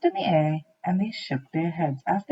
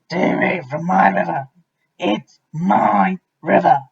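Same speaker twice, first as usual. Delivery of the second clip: shouting; 8 dB louder than usual. A narrator's reading. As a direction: bellow it like a territorial bully. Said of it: "he shouted to the creatures".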